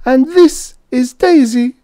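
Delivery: put-on high squeaky little old lady. A narrator's reading. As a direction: squeak it like a tiny granny. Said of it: "she announced in a squeaky voice".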